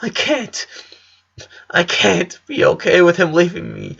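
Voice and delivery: high, tearful voice; a sister sobbing about her brother leaving, high and tearful; crying. Acted. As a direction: choke out the words through sobs. Girl sobbing over dying brother.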